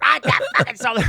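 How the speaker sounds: high-pitched outraged holler; yelling. Retold or imitated. Guitarist rejects the second song's key too, yelling. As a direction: shout it as a repeated comic outburst. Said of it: "Yelling at me".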